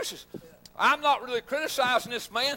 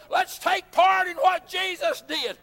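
slow, raised preaching call; preaching exhortation; 5 semitones higher, 4 dB louder, slower than usual. Acted. Preacher urging the church to follow Jesus, fervent. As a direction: urge them on, slow and strong.

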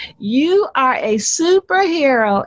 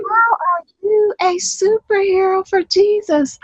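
inner thinking voice; 6 semitones higher than usual. Shown as a demonstration. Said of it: "think to yourself".

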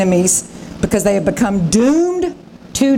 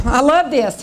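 ordinary delivery

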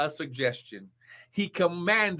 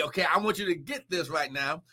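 ordinary talk, nothing marked

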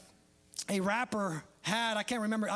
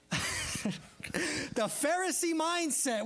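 "the Pharisee mindset" stretched very slowly with a laugh; drawn-out emphasis; 5 semitones higher, much slower than usual. Real, not acted. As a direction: savor "Pharisee mindset", slow and amused. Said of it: "I'm gonna steal this from him".

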